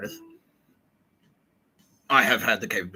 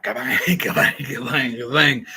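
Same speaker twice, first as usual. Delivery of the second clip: loud, higher cartoon blast noises; imitated blasts; 4 semitones higher, 8 dB louder, slower than usual. Knocking them down one after another, imitated blasts. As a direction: fire off mock explosions through a laugh.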